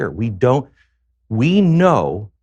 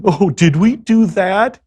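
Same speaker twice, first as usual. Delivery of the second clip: higher, louder, slow mock nerdy sitcom voice; goofy sitcom drunk; 10 semitones higher, 6 dB louder, slower than usual. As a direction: ham up a clumsy TV geek caught in a mishap. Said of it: "in the movies, they make that funny and charming".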